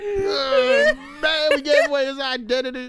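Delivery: crying with laughter; high, helpless laughter; laughing hard. Real, not acted.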